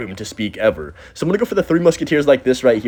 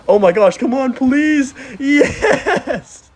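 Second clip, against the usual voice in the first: high, loud pleading into a laughing yes; excited; 13 semitones higher, 4 dB louder, much slower than usual. Real, not acted. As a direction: cheer the win in. About to three crown.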